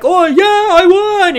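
high, loud hammed-up victory cry; mock triumphant cheer. McGillicuddy celebrating the wrong pin, high mock cheer. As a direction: shout it like a surprise winner.

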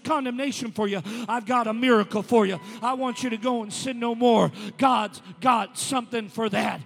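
loud, declaiming sermon cry; preaching at full force. Acted. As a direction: proclaim it to the back pews.

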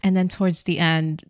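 ordinary talk

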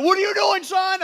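loud, high startled father's yell; retold dad shouting across the yard. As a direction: bark it at the kid like an alarmed dad. Dad catching kid with fire.

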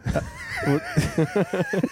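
shrill burst of laughter; high squealing laugh. Real, not acted. Big laugh.

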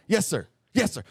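quick, nervous mock obedience; tongue-tied recruit. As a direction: mimic a rattled recruit blurting by reflex. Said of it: "so tongue-tied".

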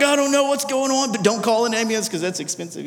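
a hint of desperate joke; strained panic. Father in pain to daughter, strained panic.

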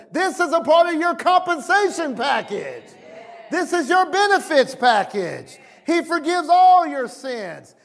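high-pitched, driving sermon delivery; impassioned preaching. Real, not acted. Preacher declaring redemption from the curse to his congregation, impassioned preaching. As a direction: preach it with rising fervor.

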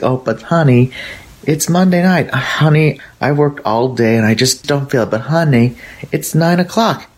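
sexy wife voice. Retold or imitated. Wife in bed coaxing husband, playfully high and coaxing. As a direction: purr honey at him, pitched well up. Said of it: "she's trying to be all sexy".

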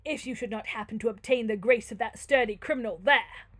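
loud, haughty lord; imperious Manfred. Acted. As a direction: declaim with tyrannical disdain.

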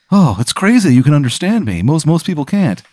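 high, chirpy bee talking; cheerful high little bee. Acted. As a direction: squeak it up like a small bee. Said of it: "he's like".

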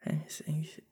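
murmured under the breath, puzzling it out; whispered to self. Real, not acted. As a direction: whisper "Famous English" to yourself while thinking. Mulling over the quiz question.

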